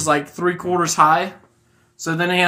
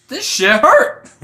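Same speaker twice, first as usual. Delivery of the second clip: higher, slow pained complaint; acted hurt, pitched up and drawn out; hurt outcry; 8 semitones higher, 4 dB louder, slower than usual. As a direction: draw out an acted cry of pain. Character hurt after falling in hole.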